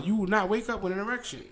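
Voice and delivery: normal talking voice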